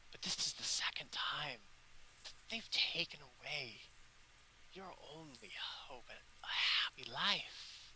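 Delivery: hushed, unvoiced comfort; soft whisper; whispered goddess. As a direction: whisper it gently to frightened children. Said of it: "she says".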